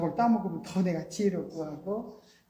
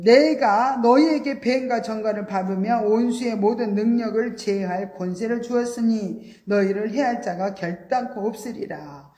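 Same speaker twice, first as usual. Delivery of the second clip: louder solemn scripture read; 3 semitones higher, 7 dB louder than usual. Acted. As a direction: proclaim the verse. Reads scripture aloud.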